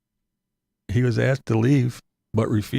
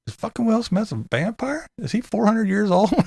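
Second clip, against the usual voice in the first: high-pitched, mildly exaggerated disbelief; mock incredulous; 10 semitones higher than usual. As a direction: ask it as a baffled, higher-voiced mock question. Acting out his incredulous reaction.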